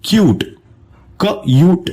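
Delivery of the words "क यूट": क यूट said as क then यूट, pitched higher; higher phonics split of क यूट; sounds split apart, higher. Shown as a demonstration. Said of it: "इसका sound बनेगा".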